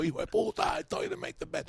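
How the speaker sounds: very high, scolding shriek; angry mother scolding. Retold or imitated. Mother hitting her son with a broom, very high angry scolding. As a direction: scream at him while chasing.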